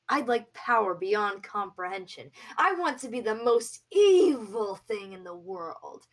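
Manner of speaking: growing power-hungry shout; villainous demand. Acted. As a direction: build to a gloating cry for power. Bard demands evil power.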